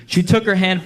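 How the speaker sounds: usual speaking voice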